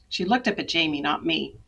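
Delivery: usual speaking voice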